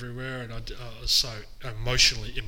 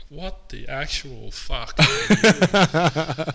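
loud, slow mock bewilderment; baffled disbelief; 4 semitones higher, 8 dB louder, much slower than usual. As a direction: imitate a dumbfounded dad, stretching the words out loud. Acts out baffled dad's reaction.

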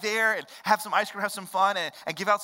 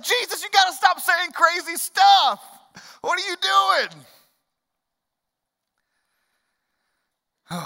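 higher and louder, pleading in disbelief; exasperated disciples; 11 semitones higher, 8 dB louder than usual. Retold or imitated. Disciples begging Jesus to stop saying crazy things: high, exasperated plea. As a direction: sound like friends begging him to stop.

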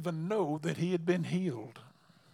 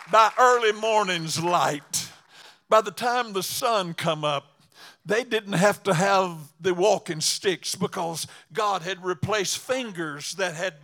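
louder preaching, rising to a proclaim; building preaching; 7 dB louder than usual. Acted. Preacher proclaims the lepers' healing to the congregation, louder. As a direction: lift it like a revival sermon.

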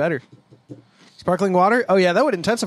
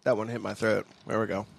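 ordinary talk

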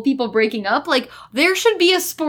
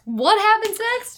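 high, slow pleading question; exaggerated baffled cry; mock bafflement; 8 semitones higher, slower than usual. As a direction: stretch it out high, hopelessly lost.

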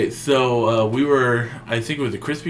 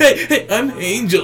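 high, eager mocking hello; mock a friend's eager intro; Angel impression; 12 semitones higher, 5 dB louder than usual. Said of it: "so you're an impressionist now?".

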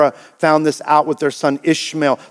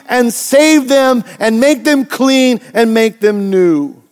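higher, louder preacher in full flow; full-force preaching; 8 semitones higher, 5 dB louder than usual. Acted. Pastor to congregation, full-force preaching. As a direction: hammer home God's power to save.

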